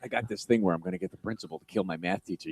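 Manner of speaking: ordinary talk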